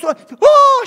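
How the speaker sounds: very high, very loud shriek; shriek of surprise. Acted. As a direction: shriek at the news. Wife shrieks on seeing it.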